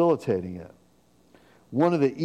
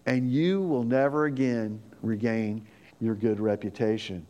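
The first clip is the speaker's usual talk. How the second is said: ordinary talk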